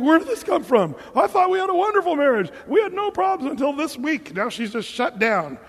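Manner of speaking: high, loud, baffled disbelief; shocked husband. Retold or imitated. Husband caught off guard, shocked and confused. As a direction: blurt it out like a blindsided husband. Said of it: "He's like".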